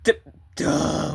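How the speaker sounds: ordinary delivery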